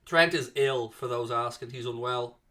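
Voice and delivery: usual speaking voice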